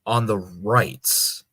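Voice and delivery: ordinary talk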